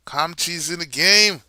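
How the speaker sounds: loud call-out at the game; yelling from the sidelines. Real, not acted. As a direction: shout it to the court. Calling out at the game.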